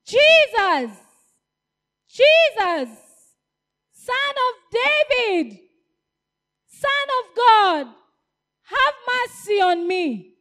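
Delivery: high, loud desperate calling; shouting. Acted. Blind man shouting for Jesus, high and loud. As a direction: cry out to be heard over the crowd. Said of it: "he kept shouting".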